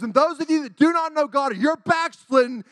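high, loud imitated preaching; revival preacher. Evangelist addressing a revival congregation, imitated forceful preaching. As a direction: proclaim it like a fired-up evangelist. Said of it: "this man was up there preaching".

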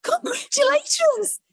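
very high, gushing retold good news; delighted congratulations. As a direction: squeal it with glee, stretching the words. Retold congratulations on dire prediction.

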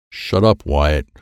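ordinary delivery, nothing marked

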